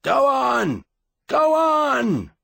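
very high, loud, strained yelling; hoarse battle shout. A narrator's reading. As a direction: whip the men on with a cracked shout. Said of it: "voice was hoarse and cracked".

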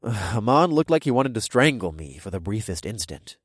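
ordinary delivery

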